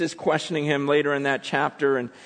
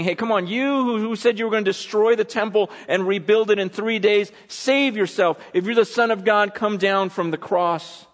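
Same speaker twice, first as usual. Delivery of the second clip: raised-pitch taunting challenge; jeering onlookers; 9 semitones higher, 5 dB louder than usual. Retold or imitated. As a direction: goad him like a jeering crowd. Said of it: "shaking their heads and they're saying".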